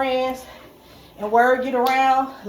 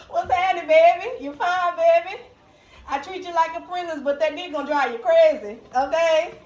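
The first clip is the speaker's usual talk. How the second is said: higher, flirty put-on Caribbean accent; 5 semitones higher than usual. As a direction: sweet-talk a lover in a playful Caribbean accent. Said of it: "from the Caribbeans".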